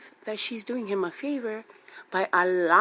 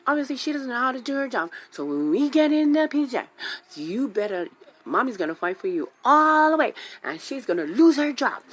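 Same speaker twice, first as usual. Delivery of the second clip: higher and louder, fast at the start; rally hard for your kid; fired-up rant; 6 semitones higher, 5 dB louder than usual. Retold or imitated. Mom vows to son to fight the teacher, loud and fired up.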